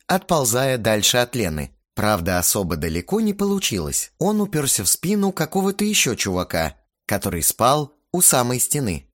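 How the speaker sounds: ordinary delivery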